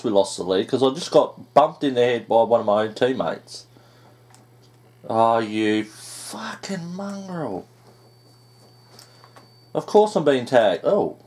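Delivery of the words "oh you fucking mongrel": drawn-out curse. Real, not acted. Cursing at an enemy.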